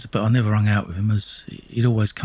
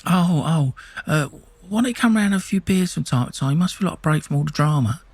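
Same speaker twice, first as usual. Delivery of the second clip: higher, oily fake-sympathy voice; sneaky Jimmy; 7 semitones higher than usual. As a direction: play him as a creepy snake fishing for news. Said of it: "whether he talked like that or not, that's how I see him".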